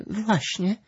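very high astonished agreement; surprised. A narrator's reading. Malwinka backs Gluś after the kick, surprised. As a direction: chime in, amazed, pitch way up. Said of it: "zdziwiła się Malwinka".

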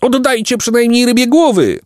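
very high-pitched pelican screech; shriek. A narrator's reading. Pelican demanding the fish heads back, shrieking. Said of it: "jeden z nich wrzasnął".

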